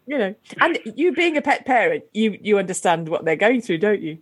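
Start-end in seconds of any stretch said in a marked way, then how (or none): none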